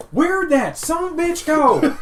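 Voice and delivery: high, loud yell through laughter; angry wife yelling. Acted. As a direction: call out for him, high and furious.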